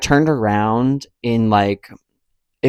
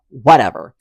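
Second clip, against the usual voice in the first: ordinary delivery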